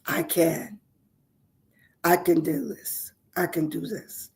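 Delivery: hushed affirmation prayer; soft gratitude prayer. Acted. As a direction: murmur thanks as a quiet prayer.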